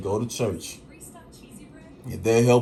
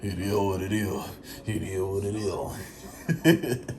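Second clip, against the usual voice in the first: Papa Leezy voice; 5 semitones lower, 7 dB quieter than usual. Retold or imitated. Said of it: "Papa Leezy voice".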